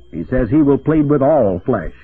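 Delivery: normal talking voice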